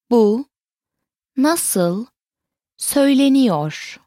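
"Bu", "nasıl", "söyleniyor", each set apart; word by word. Shown as a demonstration. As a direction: pause after "Bu" and after "nasıl". Teacher repeats it word by word.

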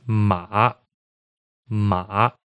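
MA dipping down, then back up; "ma" with a slight throat vibration at the bottom; third tone. Shown as a demonstration. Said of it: "hear an example of ma in third tone".

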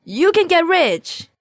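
high, drawn-out gleeful exclamation; stretched-out, high-pitched triumphant cry; excited reveal. Acted. Triumphant reveal of number one reason.

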